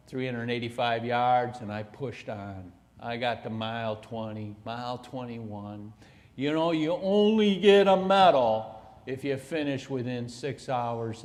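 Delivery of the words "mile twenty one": ordinary talk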